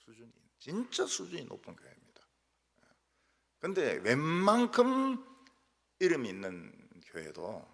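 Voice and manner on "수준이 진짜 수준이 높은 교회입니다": whispered, like a confiding aside; ironic whisper. Acted. Pastor whispering to the congregation that his church is classy, an ironic whisper. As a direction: let them in on the joke under your breath.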